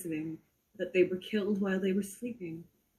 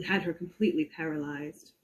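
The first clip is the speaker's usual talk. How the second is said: usual speaking voice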